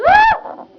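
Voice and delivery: sudden high shriek; a shrill exit scream; high shout. Acted. Puppet shouts leaving.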